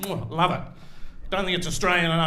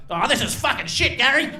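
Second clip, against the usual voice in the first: higher, louder exasperated moan at Gary; rising mock outrage, building to the name; fed-up complaint; 8 semitones higher, 5 dB louder than usual. Husband complaining to Gary mid-threesome, higher and louder. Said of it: "you're like".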